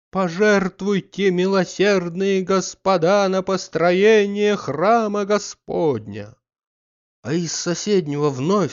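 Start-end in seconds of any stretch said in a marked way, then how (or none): 0.1-6.4 high, loud, slow chanted begging; beggar's plea. A narrator's reading. Alms collector begging the gentlemen for church money, slow loud chant. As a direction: plead for alms in a long chant. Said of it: "раздался со двора в пять голосов".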